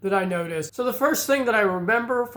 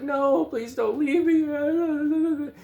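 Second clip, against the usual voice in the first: higher, very slow tearful plea; weeping child plea; 8 semitones higher, much slower than usual. Retold or imitated. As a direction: beg like a crying little kid. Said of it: "I'm weeping".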